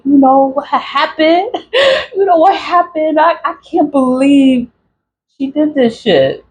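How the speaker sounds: high-pitched plaintive complaint; retold disbelief. Talking to the ancestors about betrayal, high disbelief. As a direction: report it upward in mock-wailing disbelief.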